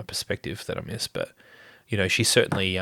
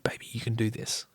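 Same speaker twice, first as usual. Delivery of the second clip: put-on whispered encouragement to a child; encouraging whisper; 6 dB quieter than usual. Dad whispering to his daughter, soft encouraging whisper. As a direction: breathe it softly, no voice.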